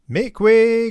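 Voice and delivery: high, loud shout over the din; blacksmith's cry. Acted. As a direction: bellow it above the clamour. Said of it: "cried the blacksmith above all the clamour".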